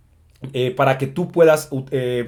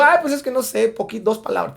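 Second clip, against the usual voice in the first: mock sheepish learner; 6 semitones higher than usual. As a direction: parody a student caught out, voice shrinking. Mocked learner admits little learned. (voz aguda y burlona de alumno avergonzado que se apaga)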